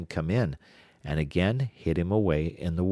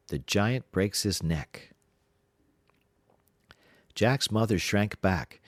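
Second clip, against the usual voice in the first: usual speaking voice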